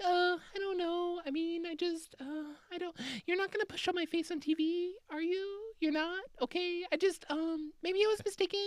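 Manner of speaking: very high, soft, hesitant mock voice; unsure American witness. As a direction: hem and haw in a tiny falsetto. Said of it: "Americans are all just like".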